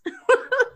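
a high, loud burst of real laughter. Real, not acted. Recalling the pillowcase she stole, real laughter. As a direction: crack up at the memory.

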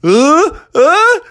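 high, gasping then slow squeal; high-pitched spicy-food distress; baby with burning mouth. Acted. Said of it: "Baby turned around and went".

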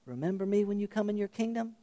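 soft, quick plea rising at the end like a question; hushed incredulous plea. Acted. Preacher asking how the thief's plea sounded, hushed and questioning. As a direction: breathe it out as a soft, wondering question.

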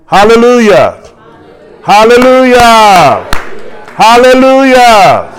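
loud, high, long-held hallelujahs; building, drawn-out preacher's shout; shouted praise. Real, not acted. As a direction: cry it out to rally the congregation. Preacher rallying congregation with shouts.

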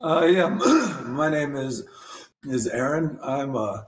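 hoarse, losing his voice; strained voice going hoarse. Real, not acted. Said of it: "I'm losing my voice".

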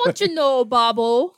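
loud cartoon mother with Wisconsin accent; Bobby's mom. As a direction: imitate a folksy cartoon mum, calling out loudly. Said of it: "you always sound like Bobby's mom".

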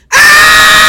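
very high, loud, sustained scream; piercing all-out release scream; full-might therapy scream. Shown as a demonstration. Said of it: "take all your might and you go".

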